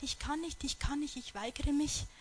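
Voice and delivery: normal talking voice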